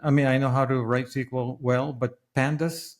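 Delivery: ordinary talk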